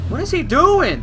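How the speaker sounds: high, loud shocked cry; shocked shriek. Real, not acted. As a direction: yelp it out in alarm. Sudden alarm in gunfight.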